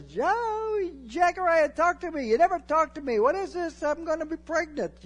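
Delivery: very high, loud shrill-free wife voice; exasperated wife. Acted. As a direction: play the flustered wife demanding answers. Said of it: "He says".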